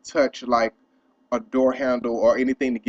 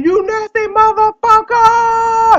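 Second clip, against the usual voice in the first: furious drawn-out yell, much higher and louder; 9 dB louder, much slower than usual. Retold or imitated. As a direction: scream it down the echoing hall. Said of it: "So I'm yelling".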